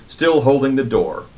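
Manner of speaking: ordinary delivery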